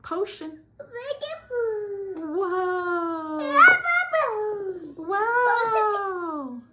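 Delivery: loud high amazed cries; yelling in delight; excited shouting. Real, not acted. Potion erupts, spiders fly.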